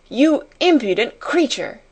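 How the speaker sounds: high, forceful rebuke; furious scold. A narrator's reading. Headmistress to the maid, furious. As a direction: spit out the insult.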